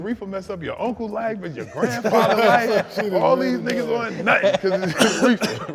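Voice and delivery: high, loud, slow granny scolding through laughter; lecturing grandma. Retold or imitated. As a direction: preach it like a stern old grandma. Grandma lecturing about reefer.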